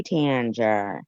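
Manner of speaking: normal talking voice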